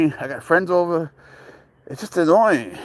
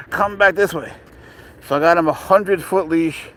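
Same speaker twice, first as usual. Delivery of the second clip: ordinary delivery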